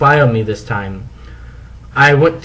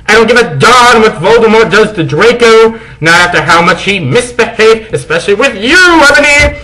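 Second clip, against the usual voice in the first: mean; 8 semitones higher, 4 dB louder than usual. Acted. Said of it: "he said meanly".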